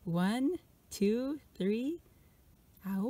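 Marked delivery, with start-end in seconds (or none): 2.9-3.1 short howl like a wolf. Shown as a demonstration. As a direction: throw back your head and howl. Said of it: "I want to hear your best imitation of a wolf howl".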